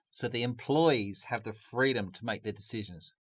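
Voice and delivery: ordinary talk, nothing marked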